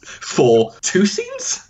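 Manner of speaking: high, drawn-out disbelieving question; incredulous. Real, not acted. Co-host baffled by an actor flying out for two scenes, incredulous. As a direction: stretch it upward in disbelief. Disbelief at tiny film role.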